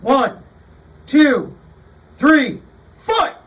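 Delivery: loud arcade-announcer count, fight shouted; fighting game announcer. Retold or imitated. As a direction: call the round like a video game announcer, building to the shout. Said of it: "the Mortal Kombat and the Street Fighter".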